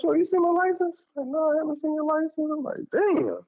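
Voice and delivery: high, frantic asking around; mock alarm. Man asking his girlfriend about the missing license, high frantic. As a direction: voice jumping up in disbelief.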